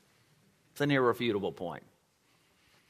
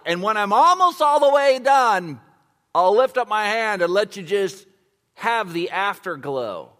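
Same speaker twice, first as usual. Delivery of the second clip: booming, higher and louder as God speaking; God's voice, preached; 9 semitones higher, 8 dB louder than usual. Acted. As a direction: preach God's words at full force. Voices God's promise, full force.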